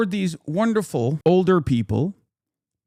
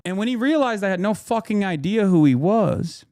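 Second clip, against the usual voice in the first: ordinary delivery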